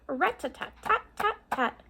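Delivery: high tapping rat-a-tat; woodpecker rat-a-tat. Acted. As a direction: peck it out like a woodpecker. Woodpecker sound imitated.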